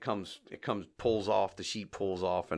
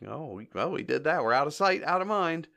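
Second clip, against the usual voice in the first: lightly voiced quote, pitched up; mimicked characters; 6 semitones higher than usual. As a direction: act out their excuse, rising higher toward the end. Acts out characters' thought.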